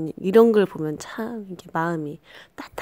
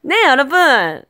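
raised voice tone; 7 semitones higher, 7 dB louder than usual. Shown as a demonstration. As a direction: voice pitched up high and louder, to sound less sick. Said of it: "제가 목소리 톤을 좀 올려봤습니다". (목소리 톤을 확 올려서)

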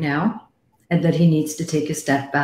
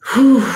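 tired whew; 8 semitones higher, 9 dB louder than usual. Real, not acted. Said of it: "I'm exhausted".

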